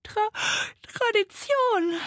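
high ghost voice stretched by a yawn. A narrator's reading. Tired ghost answering the sloth, high drawn-out yawn. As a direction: break off and yawn through the word. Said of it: "sagte das Gespenst und gähnte".

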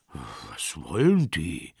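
low bear voice; low, puzzled bear question; wondering bear. Acted. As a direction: ask it as a deep-voiced bear. Bear asks about noise outside.

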